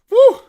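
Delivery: loud high whoop; joyful whoop. Real, not acted. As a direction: let out a victory whoop. Price spikes, cheer.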